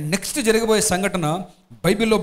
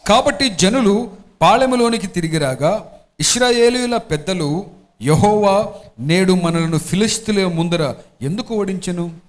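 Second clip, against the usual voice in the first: slow, louder, measured reading of scripture; 5 dB louder, slower than usual. Acted. Pastor reading scripture to the congregation, slow and solemn. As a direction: read it out to the congregation, weighty and slow. Pastor reads Bible passage aloud.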